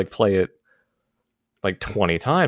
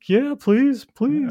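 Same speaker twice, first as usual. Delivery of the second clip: squeaky high begging please; high pleading; much slower than usual. Retold or imitated. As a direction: beg in a tiny high voice. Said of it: "I'm like".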